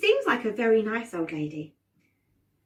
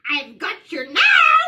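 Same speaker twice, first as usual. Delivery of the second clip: high, loud, drawn-out witch gloat; 8 semitones higher, 10 dB louder, slower than usual. Shown as a demonstration. Leading everyone as the witch who caught them, gloating witch. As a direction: crow it slowly like a triumphant witch. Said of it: "say that on the count of three".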